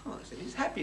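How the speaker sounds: usual speaking voice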